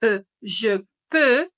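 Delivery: "E, je peux" with a rounded eu, slowly; French eu sound. Shown as a demonstration. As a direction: say "peux" with lips rounded like U but thinking E. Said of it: "atención a la pronunciación".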